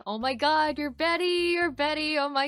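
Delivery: loud, excited fan squeal; mock fan gush. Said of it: "people commenting on my videos saying".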